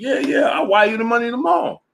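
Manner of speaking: cocky high mock shout; mock Ryan voice. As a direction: shout it like a breezy promise. Ryan voiced promising to pay.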